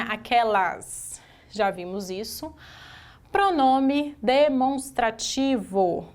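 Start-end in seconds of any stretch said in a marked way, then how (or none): 3.3-6.1 slow dictation. Shown as a demonstration. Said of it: "teacher dictating the answer".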